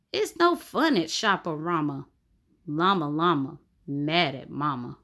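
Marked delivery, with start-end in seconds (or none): none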